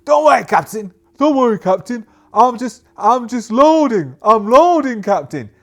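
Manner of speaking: high, loud, flustered reassurance; nervous soldier. Acted. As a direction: babble it, panicky and loud. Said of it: "X O goes".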